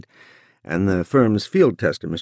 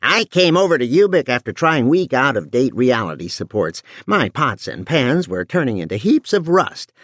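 brassy bellowing housewife; 6 semitones higher than usual. A narrator's reading. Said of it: "In a brassy voice, she bellowed".

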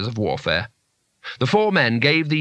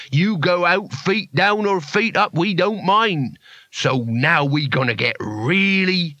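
high, slow thug voice; drawn-out menacing threat; 5 semitones higher, slower than usual. A narrator's reading.

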